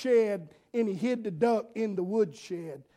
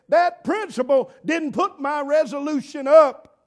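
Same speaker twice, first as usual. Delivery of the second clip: loud, higher indignant complaint; mock angry teacher; 5 semitones higher, 8 dB louder than usual. Preacher voicing a young teacher's angry complaint. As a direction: huff like a mad setting hen. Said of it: "she got mad".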